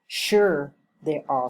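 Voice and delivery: slow, "Sure" stressed and set apart. Shown as a demonstration. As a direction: lean on "Sure" at the start, then drop off. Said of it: "the stress is on sure".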